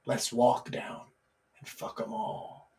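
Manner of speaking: low, slow and hushed; unhurried quiet punchline, fading to a whisper; old bull. Acted. Old bull answering the young bull, slow and quiet.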